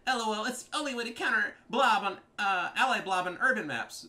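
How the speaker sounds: high, loud mocking voice; mock whiny opponent. Player reading rival's excuse for blobbing, high mock whine.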